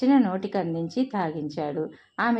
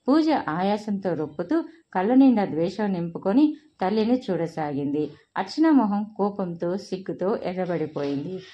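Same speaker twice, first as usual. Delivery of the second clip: plain reading voice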